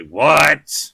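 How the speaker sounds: loud, high, annoyed outburst; indignant shout; incredibly upset. Acted. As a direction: yell it as if just rudely woken. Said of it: "She is incredibly upset with you".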